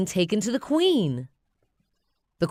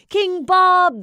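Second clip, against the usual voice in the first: much higher, louder chant; crowd cheering; 14 semitones higher, 10 dB louder than usual. Acted. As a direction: cheer the new king. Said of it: "they cry".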